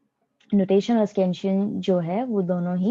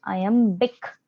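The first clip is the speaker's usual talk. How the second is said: "iambic" broken up, weight on the final "bic"; stress on last syllable. Shown as a demonstration. Teacher showing where the stress falls, last syllable of "iambic" stressed. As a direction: lean on the end of "iambic". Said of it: "उस पर stress किया जा रहा है".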